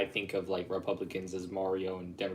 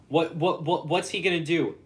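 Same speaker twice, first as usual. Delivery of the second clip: higher, louder, worked-up question; excited; 7 semitones higher, 7 dB louder than usual. Real, not acted. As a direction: fire it off, getting worked up.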